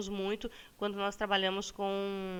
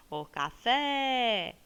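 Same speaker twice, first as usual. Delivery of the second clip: "café" stretched on fé, like calling someone; calling the word; 3 semitones higher, 4 dB louder, much slower than usual. Shown as a demonstration. Teacher calls the word to find its stressed syllable, drawn-out call. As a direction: call out "café", drawing the last syllable long and high. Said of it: "chama a palavrinha".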